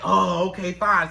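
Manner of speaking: low, resigned agreement; reluctant giving in. Acted. Owner giving in to the begging dog, resigned. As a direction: give in to a nagging pet. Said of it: "And you like".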